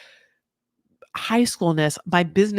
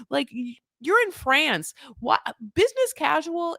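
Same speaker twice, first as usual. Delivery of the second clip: high-pitched, exasperated disbelief; baffled, scandalized questioning; mock incredulity; 7 semitones higher than usual. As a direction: demand an explanation, pitch shooting up. Host mock-scolds Manon's outfit choice.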